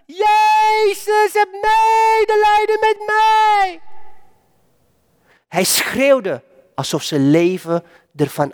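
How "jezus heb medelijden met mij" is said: long, high, drawn-out cry for mercy; desperate beggar's drawn-out call. Acted. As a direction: cry it out across the crowd, slow and high. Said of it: "hij gaat roepen".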